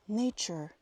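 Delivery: "nature" with a clear ch, NAY-chur; ch sound in nature. Shown as a demonstration. Teaching Spanish speakers, "nature" with a ch sound. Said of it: "se pronuncia como una C H".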